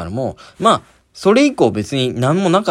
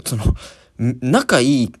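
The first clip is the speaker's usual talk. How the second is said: normal talking voice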